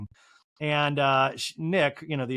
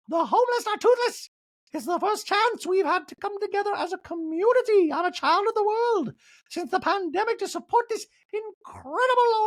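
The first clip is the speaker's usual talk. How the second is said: very high hammed-up hostess falsetto; whoop, announce grandly, stretch the final name; Dorit; 4 dB louder than usual. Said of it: "Dorit makes her way onto the stage".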